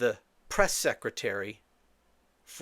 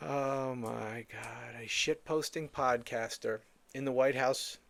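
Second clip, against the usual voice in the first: stunned disbelief; 2 semitones lower, 6 dB quieter than usual. Real, not acted. Host reacting to an absurd briefing question, quietly appalled. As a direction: say it softly, as if worn down by disbelief. Stunned after replaying the clip.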